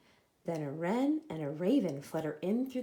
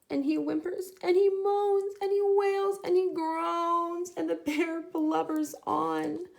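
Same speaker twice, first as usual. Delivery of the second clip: blubbering narration; 9 semitones higher, 6 dB louder than usual. A narrator's reading. Narrating bear crying.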